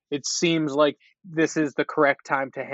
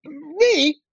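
high, louder, hammed-up feigned innocence; mock innocent fouler; 12 semitones higher, 5 dB louder, slower than usual. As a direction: protest your innocence to the ref like a guilty player. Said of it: "turning and be like".

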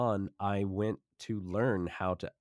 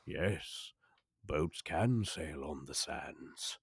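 grand narrator voice. Pretend. Guest describing the pirate ship boat in narrator voice. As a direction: deliver it like a film narrator. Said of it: "I didn't know you had this voice".

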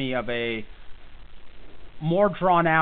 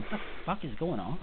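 bewildered Casey; 10 dB quieter than usual. Retold or imitated. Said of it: "Casey will just be sitting there going".

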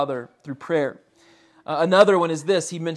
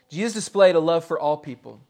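usual speaking voice

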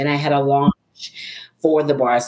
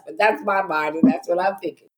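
ordinary talk, nothing marked

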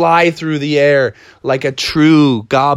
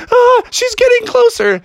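very high mock-terrified cry; panicked frogs; 4 dB louder than usual. Frogs chased by Anne, high panicked squeal.

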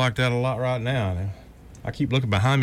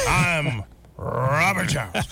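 slow radio sign-off, lightly voiced; a hint of an old-time announcer, slow; old radio newsman; 2 semitones higher, slower than usual. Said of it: "this old rough radio voice".

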